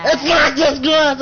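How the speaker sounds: high, loud, drawn-out acted scream; anguished shout. Old man defends his glass before punching, anguished shout. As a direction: explode in hammed-up outrage, stretching the words.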